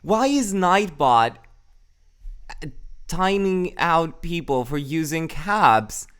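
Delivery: baffled and outraged at the bot; indignant surprise. Real, not acted. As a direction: streamer indignant that the bot is timing people out.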